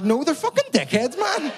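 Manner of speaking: high, loud outraged whine, pitch cracking up; a teen losing it at his mum. Retold or imitated. Teen son to mum on the phone, outraged high whine. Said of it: "I was like".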